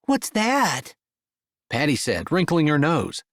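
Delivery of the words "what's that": put-on high curious child; little girl voice.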